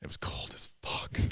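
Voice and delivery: whispered aside. Real, not acted. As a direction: breathe it out, almost unvoiced. Recalling the cold October swim.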